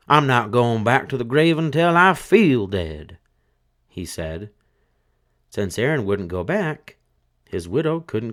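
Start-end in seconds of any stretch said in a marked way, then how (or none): 0.1-3.2 louder, higher stubborn corpse voice; stubborn dead man. A narrator's reading. Dead man refusing his widow, louder. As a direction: dig in and refuse.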